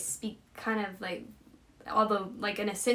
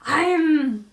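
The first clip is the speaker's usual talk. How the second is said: loud, higher attempt at a Scottish accent; 6 semitones higher, 10 dB louder than usual. Shown as a demonstration. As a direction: launch into a put-on Scottish accent. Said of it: "I get a Scotland accent".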